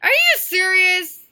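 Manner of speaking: shouted high in disbelief; loud indignant shout; outraged disbelief. Real, not acted. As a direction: yell it, offended. Friend calls her mom.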